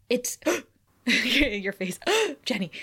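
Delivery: slow, breathless gasps at a lost love; mild imitated gasping wonder; gasping in awe. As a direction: gasp out the words in disbelief. Giles sees Jenny again.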